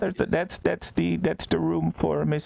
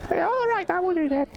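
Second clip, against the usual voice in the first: high, put-on squeaky voice. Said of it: "So I'm so bad with voices".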